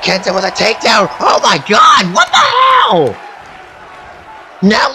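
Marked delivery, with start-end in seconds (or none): 1.2-3.1 loud, high, drawn-out what the hell; shocked yell. Real, not acted. Commentator losing it over a takedown, loud high yell. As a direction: holler in stunned disbelief.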